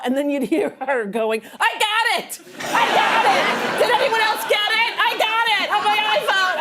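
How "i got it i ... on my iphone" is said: imitating a loud New Yorker, higher and excited; lightly mock the friend yelling about her phone video; a hint of a brash New York tourist shouting. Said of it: "she's a very loud New Yorker".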